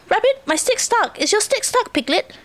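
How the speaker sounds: high little character voice; fretting young Roo. A narrator's reading. Roo asks friends about stuck sticks, high and eager. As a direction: pipe up high to your friends.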